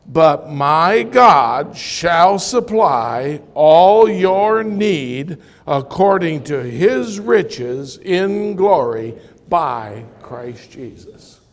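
stately public Bible reading; solemn scripture reading. Acted. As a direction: declaim the verse word by word. Reads verse aloud to congregation.

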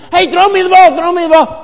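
high, loud pretend fan call; high yelling fan in the stands; calling for the ball. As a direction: beg a player for the ball.

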